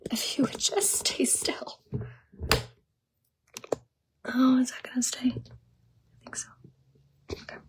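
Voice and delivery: quiet whisper to herself while fixing the tripod. Real, not acted. Fixing her car tripod, whispered to herself. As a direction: mutter under breath, fiddling.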